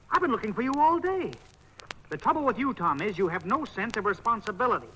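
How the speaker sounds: high, loud exasperated telling-off; scolding. Acted. Friend scolds Tom after searching all day, exasperated. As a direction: let the whole day's worry out.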